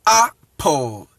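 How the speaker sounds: APPLE slowly split, "AH... pol"; Akan A in APPLE. Shown as a demonstration. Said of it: "trying to use the original alphabet".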